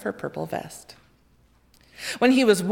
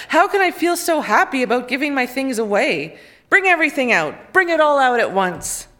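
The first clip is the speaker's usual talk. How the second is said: louder, higher happy orders; joyful commands; 5 semitones higher, 5 dB louder than usual. A narrator's reading. The joyful king calls to bring out all his gifts. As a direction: call out for everything gleefully. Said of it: "he cried".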